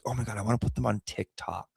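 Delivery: soft, fast, hushed gush; hushed excited aside. Retold or imitated. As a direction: rush it out under your breath. Retold excited thought.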